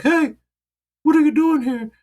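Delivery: put-on high, loud old Danish lady voice; an old Danish woman scolding in a high Danish accent. Old Danish woman catching a stranger at the grave, in a high Danish accent. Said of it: "Doing my Danish accent".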